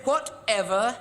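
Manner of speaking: high, drawn-out mimicking of his wife; husband imitating his wife's whatever. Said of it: "sort of like that".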